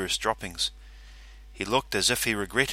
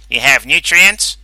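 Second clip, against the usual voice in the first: gruff character voice; 4 semitones higher, 13 dB louder than usual. Acted. Calverley questions the salesman.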